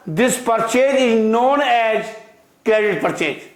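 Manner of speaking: very slow, word by word dictation; dictating at a crawl. Shown as a demonstration. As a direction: spell it out slowly for students to write. Teacher dictating slowly to class.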